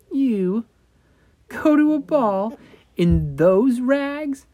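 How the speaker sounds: high mocking sneer; cackling stepsisters. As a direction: sneer it out like a spiteful sister. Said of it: "they cackled".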